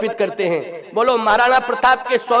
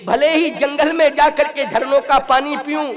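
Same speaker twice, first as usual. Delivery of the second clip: high oratory climbing to a loud peak; rousing heroic vow; 7 semitones higher, 3 dB louder than usual. Acted. Preacher voicing Maharana Pratap's vow, rousing heroic oratory. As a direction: build the vow into a ringing declamation.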